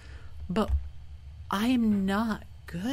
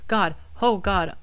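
ordinary talk

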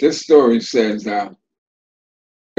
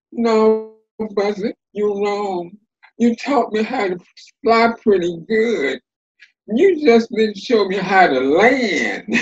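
Terrapin's comeback; 7 semitones higher than usual. Acted. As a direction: answer Buzzard with a sly higher voice. Terrapin's punchline reply.

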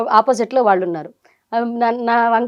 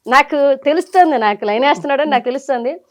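ordinary talk, nothing marked

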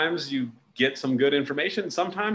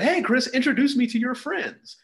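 cheery high hello; 11 semitones higher, 4 dB louder than usual. Retold or imitated. Walking up to acquaintance.

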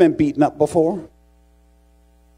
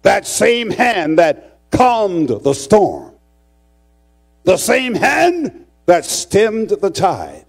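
high, loud, drawn-out proclamation; ringing pulpit declaration, word by word; forceful preaching; 7 semitones higher, 5 dB louder, much slower than usual. Real, not acted. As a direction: preach it with rising fervor. Preacher builds at full force.

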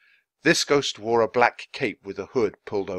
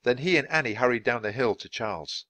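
ordinary delivery, nothing marked

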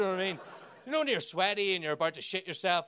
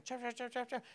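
bird chirp imitation; 5 semitones higher, 11 dB quieter than usual. Imitating birdsong.

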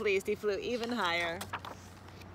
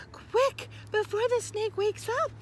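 high, urgent mother frog; panicked urging; 12 semitones higher, 4 dB louder than usual. A narrator's reading. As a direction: hurry them away in a high voice. Mother urges frogs to flee.

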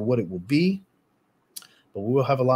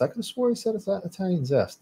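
surprised disbelief; 9 semitones higher than usual. Real, not acted. Doubting himself mid-sentence.

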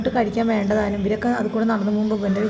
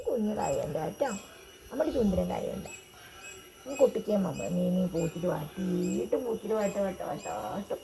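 hushed cooing; 3 semitones lower, 11 dB quieter than usual. Real, not acted. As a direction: murmur sweet nothings to the dog. (നായയോട് പതിഞ്ഞ സ്വരത്തിൽ കൊഞ്ചൽ)

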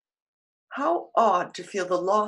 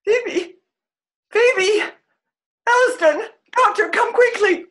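high, loud panicked cries; screaming for help over a dying friend; frantic shouting; 10 semitones higher, 8 dB louder than usual. Acted. She shouts for the doctor as Phoebe dies.

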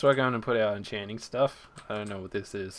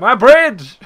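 higher, loud cry of dismay; anguished shout; 10 semitones higher, 15 dB louder than usual. Real, not acted. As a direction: yell it as the bridge blows. Creeper blows up bridge.

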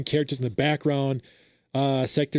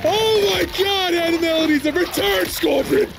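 ecstatic fan yelling; slower than usual. Real, not acted. Fan hears Animalities are back and yells with joy. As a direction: lose it with joy as the feature returns. Fan reacting live to trailer.

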